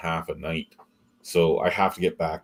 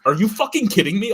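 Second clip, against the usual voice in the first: disbelieving boss; 12 semitones higher, 5 dB louder than usual. Retold or imitated. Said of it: "She goes".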